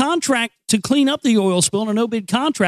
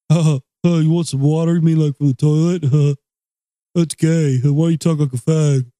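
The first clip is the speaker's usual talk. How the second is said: dumb movie character; 3 semitones lower than usual. Retold or imitated. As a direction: play the dopey Idiocracy guy. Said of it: "that movie Idiocracy".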